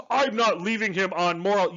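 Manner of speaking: hammed-up self-righteous hero voice; mock moral grandstanding. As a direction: parody of a preachy action hero. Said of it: "the manufactured drama".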